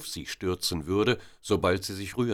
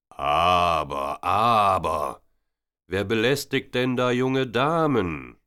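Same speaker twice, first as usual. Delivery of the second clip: loud, authoritative officer stepping in; commanding policeman; 3 semitones higher, 7 dB louder, slower than usual. A narrator's reading. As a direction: stop the harassment with one firm call. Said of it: "eine befehlsgewohnte Stimme". (laut und befehlsgewohnt, ein Polizist greift ein)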